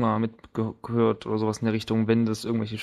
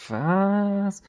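sharp, much higher "Was?" in disbelief; surprised what; 10 semitones higher than usual. Real, not acted. Player baffled by a teammate's remark, sharp surprised yelp. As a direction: jolt up on Was as if caught off guard.

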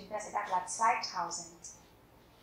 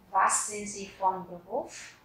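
slow repeat; slower than usual. Shown as a demonstration. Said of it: "teacher repeats question".

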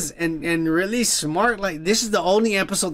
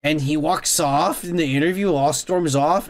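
ordinary talk, nothing marked

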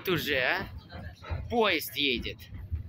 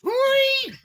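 sudden high, loud cry of surprise; high startled shout; startled yelp; 10 dB louder than usual. Real, not acted. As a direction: yelp as the train jerks. Train jolts, child yelps.

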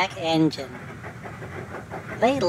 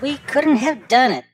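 high and louder praise; grateful exclaim; 7 semitones higher, 5 dB louder than usual. Acted. Engines praising the caboose, high and loud. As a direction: burst out in thanks.

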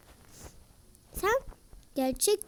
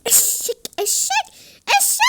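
mocking taunt; 10 semitones higher, 4 dB louder than usual. Real, not acted. Taunting a player.

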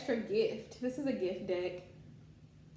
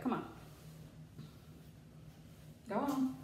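ordinary delivery

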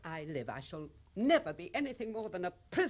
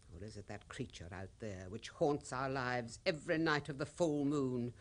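normal talking voice

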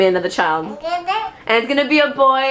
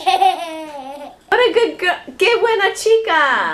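gushing praise; 7 semitones higher, much slower than usual. Real, not acted. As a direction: coo it big and slow to a little one. Gushing praise to little girl.

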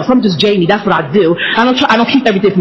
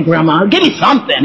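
usual speaking voice

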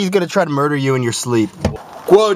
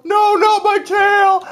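high, slow shouted whale wail; screaming whale; slower than usual. Pretend. Voicing a whale crying about its tail, shouted.

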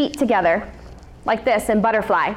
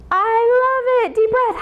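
excited praise; 10 semitones higher than usual. Acted. Delighted teacher praising kids.

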